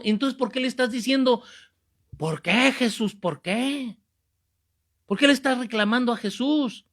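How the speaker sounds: loud, high indignant rebuke; acted scolding. Priest rebuking the man for blaming Jesus, acted scolding. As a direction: tell someone off, slowing for the final blow.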